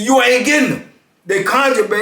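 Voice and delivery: high-pitched mock refusal; acted-out firm denial, pitched well up; retold refusal. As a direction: imitate an officer shutting down a request. Retold officer refusing inmate.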